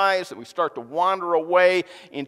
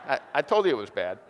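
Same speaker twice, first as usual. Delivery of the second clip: ordinary talk, nothing marked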